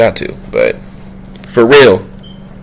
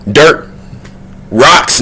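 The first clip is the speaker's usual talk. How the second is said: ordinary talk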